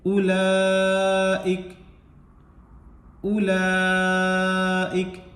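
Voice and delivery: उलाइक chanted slowly, ला drawn long, ending on a silent काफ; stopping recitation. Shown as a demonstration. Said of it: "काफ साकिन करके पढ़ देंगे".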